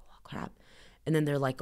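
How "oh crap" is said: dropped to a near whisper, much softer; whispered dismay at the surgery news; stunned hush. Retold or imitated. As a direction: breathe it out in shock under your breath.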